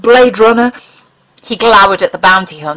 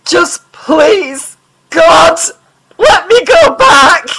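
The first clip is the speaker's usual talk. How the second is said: much higher and louder, slow sobbing scream; desperate screaming plea; 9 semitones higher, 6 dB louder, much slower than usual. Acted. Mulder screams to the alien to send him back, sobbing. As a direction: break down into a wail. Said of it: "his sobs choked him".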